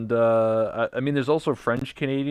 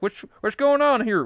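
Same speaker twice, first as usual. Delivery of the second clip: Southern hillbilly; 13 semitones higher, 6 dB louder than usual. Retold or imitated. Cottage locals confronting a city visitor, mock Southern hillbilly accent. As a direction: do a hammed-up American Southern accent. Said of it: "They sound like from the, from the South".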